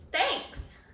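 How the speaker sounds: annoying thanks. Retold or imitated. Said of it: "In, like, the most, like, annoying way".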